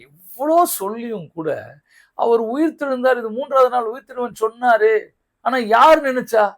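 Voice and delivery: loud, high-pitched preacher's challenge; impassioned preaching. Real, not acted. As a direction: drive it home to the congregation, high and forceful. Preaches at full force.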